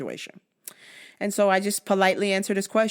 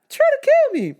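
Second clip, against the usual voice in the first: much higher, squealed in mock outrage; 5 dB louder than usual. Host squealing at attackers trying to kill him. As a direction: squeal it like you can't believe it.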